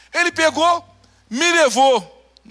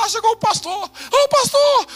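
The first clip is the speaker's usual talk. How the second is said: imitated tearful cry; 11 semitones higher than usual. Old man greeting the pastor's arrival, imitated tearful wail. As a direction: wail it out, pitched way up, as if crying. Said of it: "ele começou a chorar".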